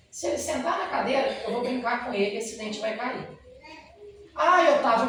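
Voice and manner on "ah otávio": loud, higher plaintive "Ah, Otávio"; mother begging the dentist to wait; pleading mother. Retold or imitated. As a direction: cry out his name like a frightened mother.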